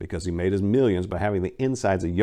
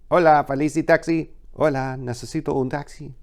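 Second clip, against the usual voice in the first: higher, crisp Spanish phone greeting; Spanish phone exchange; 5 semitones higher than usual. Acted. Taxi operator and Maria on the phone, in Spanish. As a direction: answer the taxi line briskly in Spanish.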